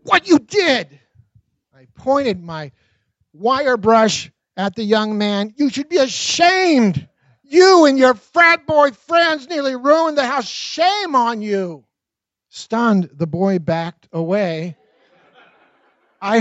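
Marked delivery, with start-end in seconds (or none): 0.0-1.0 higher, loud, accusing; angry accusation. A narrator's reading. Angrily confronting the frat boy. 5.6-11.8 higher, slow, louder rebuke; furious scolding. A narrator's reading. Scolding the frat boy.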